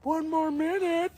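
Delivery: very high, loud, stretched-out call; taunting singsong. Retold or imitated. Prankster calls to waiting friend from bathroom, taunting singsong. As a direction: stall him with long, teasing high notes.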